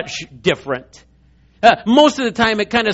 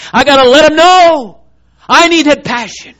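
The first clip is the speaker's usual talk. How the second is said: louder, higher passion; passionate cry; 8 semitones higher, 8 dB louder than usual. Real, not acted. Preacher to congregation about burning calling, loud and passionate. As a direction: let it burst out.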